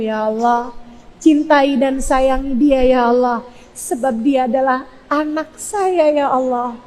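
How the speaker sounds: slow plea with sinking endings; pleading prayer. Shown as a demonstration. Mother begging God to guide her child, slow imploring prayer. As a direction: draw out each request to God. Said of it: "Doa begitu".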